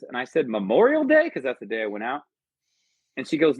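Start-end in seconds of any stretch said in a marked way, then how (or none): 0.5-1.3 jumps up high in bewilderment; confused answer. Retold or imitated. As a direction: blurt it out very high, lost.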